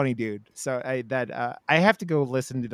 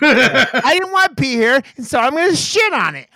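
put-on grumpy toddler; 11 semitones higher, 7 dB louder than usual. Mocking the grumpy mood.

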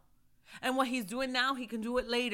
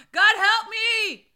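high, slow plea to God; crying out to God; 8 semitones higher, 4 dB louder, slower than usual. Acted. Preacher crying out to God for help. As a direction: cry it out, pleading. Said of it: "I cried to my God for help".

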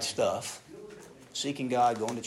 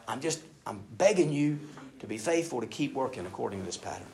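ordinary delivery

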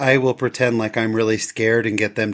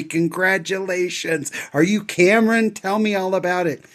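higher, bright-eyed stranger gushing, lightly voiced; excited congratulations; 7 semitones higher than usual. Strangers congratulating his adopted son.

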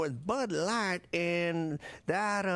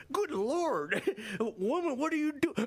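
startled protest; 5 semitones higher than usual. Acted. Woman grabs his mic.

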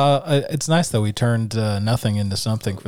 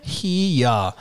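mocking cowboy holler; 3 semitones higher than usual. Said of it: "Nice, dude".

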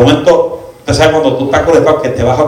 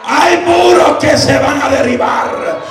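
raised, driving Pentecostal preaching voice; full-force preaching; 10 semitones higher than usual. Acted. Preacher calling the church to praise, preaching at full force.

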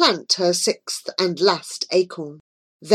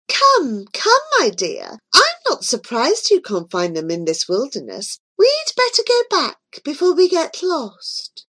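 queen; 7 semitones higher than usual. A narrator's reading. Queen speaking to Joy.

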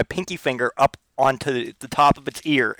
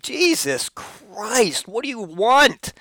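high, drawn-out put-on voice; exasperated reply; 9 semitones higher, much slower than usual. Owner answering the yowling cat, high and exasperated. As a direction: stretch it out, high and fed up. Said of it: "It's like".

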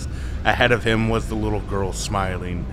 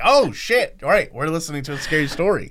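startled; 8 semitones higher, 4 dB louder, faster than usual. Retold or imitated. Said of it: "and I was like".

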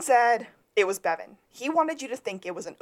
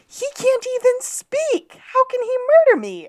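very high, loud mock disbelief; [name]; 9 dB louder than usual. Podcaster acting the judge belittling a rival, high and loud. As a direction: sail way up high and scoff loudly. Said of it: "[name] does his number twenty-one".

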